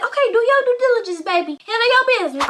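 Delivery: high, loud cheering-on; hype-woman impression. Miss Kathy urging the girl to handle it, hyped. Acts out Kathy egging her on.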